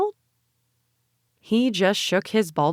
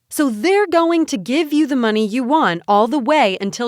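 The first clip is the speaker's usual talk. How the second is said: louder, higher, building rant; angry tirade; 7 semitones higher, 4 dB louder than usual. A narrator's reading. Rhonda ranting at Benny about the donors, building louder. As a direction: pile it on, getting angrier.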